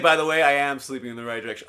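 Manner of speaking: retold remark, blurted loud and quick; loud mock announcement. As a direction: announce it loudly and fast, imitating a friend. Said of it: "She's like".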